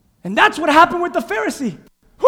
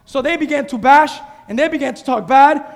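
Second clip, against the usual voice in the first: usual speaking voice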